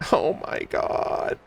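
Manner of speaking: whispered. Real, not acted. Breathless after the reveal.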